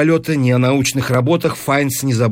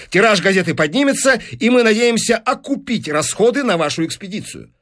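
high-pitched, animated sales pitch; persuasive newspaper businessman; 8 semitones higher than usual. Acted. Businessman selling the professor on records, persuasive. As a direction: talk up the profits with relish.

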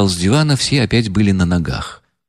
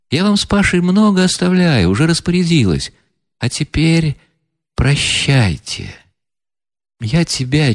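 higher, softened woman's voice saying goodbye; tearful farewell heroine; 4 semitones higher than usual. Acted. Настасья Филипповна прощается с князем, мягко и со слезами. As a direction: let her part kindly, holding back tears. Reader voices Nastasya Filippovna.

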